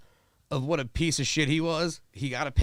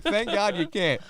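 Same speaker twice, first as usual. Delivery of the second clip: ordinary talk, nothing marked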